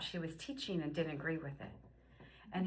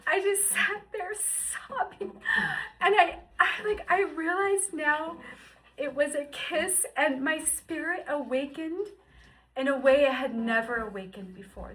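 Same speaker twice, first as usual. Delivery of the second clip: moved to tears; 7 semitones higher, 7 dB louder than usual. Real, not acted. Emotional memory, laughing-crying.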